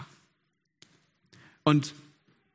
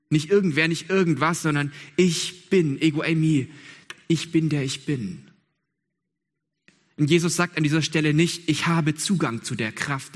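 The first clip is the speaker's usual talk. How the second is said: louder, hammering each nicht and bin; fervent preaching; 3 semitones higher, 3 dB louder than usual. Acted. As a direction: proclaim ich bin der ich bin like a declaration. Preaching climax on ich bin.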